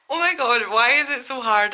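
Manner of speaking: high, loud burst of frustration; real exasperated outburst. Real, not acted. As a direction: vent it, starting high and easing down. Venting about filming accent tag.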